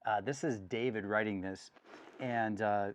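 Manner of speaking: normal talking voice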